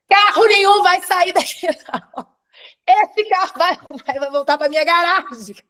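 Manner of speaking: high, loud, defiant declaration; acted shouted command. Woman ordering her car back to her garage, loud and high. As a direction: shout it as a dramatic decree.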